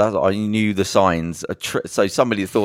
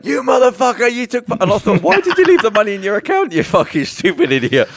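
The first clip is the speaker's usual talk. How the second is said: high, loud mock outrage; angry scammer; 5 dB louder than usual. Scammer emailing the man who tricked him, hammed-up high fury. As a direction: rant furiously, pitched way up.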